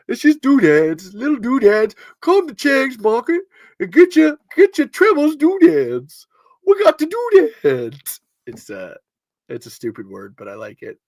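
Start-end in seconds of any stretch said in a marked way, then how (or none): 0.1-8.2 high, drawn-out doodads sales pitch; hamming up a silly shop ad; mock shopkeeper pitch. Amused host hamming up a shop ad for doodads, high and slow. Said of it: "I love saying the word doodads".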